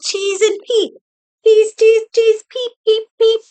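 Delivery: little puff voices, high and punchy; chirping little puffs. A narrator's reading. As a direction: chirp it like tiny creatures.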